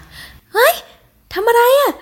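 very high-pitched, scandalized squeal; shocked cry. A narrator's reading. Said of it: "ซาเรียร้องพลางยกมือปิดตา". (ร้องเสียงแหลมสูงด้วยความตกใจ)

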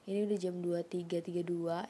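ordinary delivery

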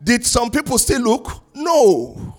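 fervent preaching. Acted. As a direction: preach it out to the congregation. Pastor preaching with fervour.

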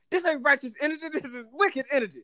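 put-on high West Indian accent, preaching about energy. Cook lecturing rude customer, West Indian accent. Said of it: "a West Indian accent".

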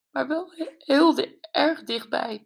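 high squeak, breaking off mid-word; high broken squeak; squeaky, tearful. A narrator's reading. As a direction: squeak it out, close to tears. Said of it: "piepte ze".

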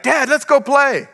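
put-on high little boy voice, eager; child voice. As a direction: play the excited young son. Preacher voicing his young son.

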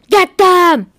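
angry guard ordering the crowd to charge; yell. Acted. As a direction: shout the command to attack. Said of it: "the human yelled".

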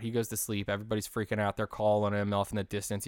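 ordinary delivery

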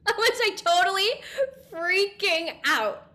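high, laughing, broken-up delivery. Real, not acted. Speaker recalling own freak-out, laughing through words. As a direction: laugh at your own meltdown as you say it.

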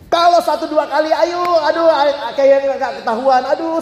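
high, hammed-up "aduh" exclamations; mock relief. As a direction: act out a cheater hoping to get away. Acting a nervous cheater's thoughts.

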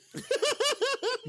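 loud laugh. Real, not acted. Laughing at picture.

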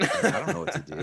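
laughing through the words. Real, not acted. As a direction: chuckle as you say it. Laughing while retelling.

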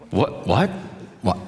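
hammed-up startled "what, what"; mock surprise. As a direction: play a startled double-take. Moses fails to object.

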